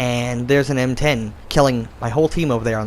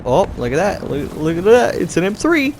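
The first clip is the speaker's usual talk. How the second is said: excited; 7 semitones higher, 3 dB louder than usual. Real, not acted. Spotting an enemy tank.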